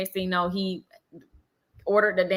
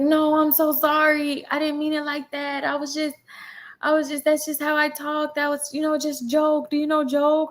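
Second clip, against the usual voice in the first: mock apology; 7 semitones higher than usual. Imitating man apologizing with accent.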